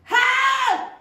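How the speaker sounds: a high, loud panicked scream of "Help!". Acted. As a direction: yell for help as if in danger.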